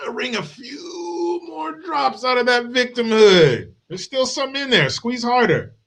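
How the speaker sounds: mock her as if squeezing a rag dry; shrill sarcastic taunt; mocking shout.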